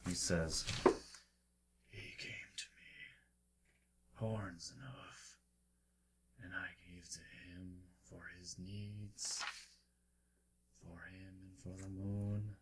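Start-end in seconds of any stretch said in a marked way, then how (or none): 1.9-12.6 slow, hushed stranger's whisper. Acted. One-armed kneeling man to approaching travellers, whispering. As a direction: whisper it in fragments, with long pauses. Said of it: "you can hear him whispering".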